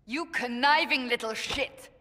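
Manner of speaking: furious shout. Acted. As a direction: hurl it at him like a betrayal. Insulting Varric mid-quarrel.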